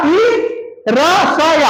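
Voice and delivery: ordinary delivery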